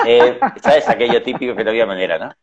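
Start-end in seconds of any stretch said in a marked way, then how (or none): 0.1-0.9 risa aguda. Real, not acted.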